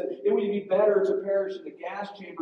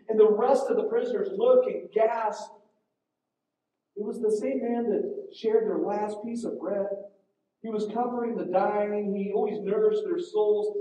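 ordinary talk, nothing marked

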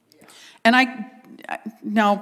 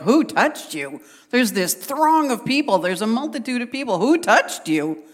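swooping, exaggerated disbelief; baffled followers pointing out the obvious; incredulous disciples; 4 semitones higher than usual. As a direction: stretch the question out as if it's absurd. Disciples' incredulous reply to Jesus, acted.

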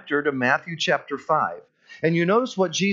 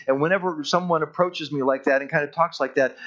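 ordinary talk, nothing marked

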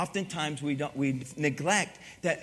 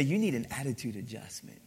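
lightly voiced dad, lower and softer; father's stock admonition; 6 semitones lower, 6 dB quieter than usual. Pastor quoting his father's correction to him, lightly imitated low fatherly admonition. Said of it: "My father used to say it like this".

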